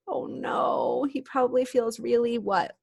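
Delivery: ordinary delivery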